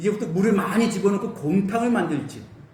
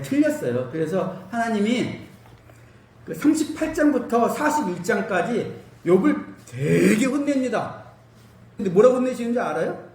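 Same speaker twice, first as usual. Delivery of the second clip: ordinary delivery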